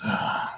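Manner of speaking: breathed-out sigh. Acted. Captor looking at silent prisoner, sighing. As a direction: let out an acted sigh. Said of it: "He looks at you and sighs".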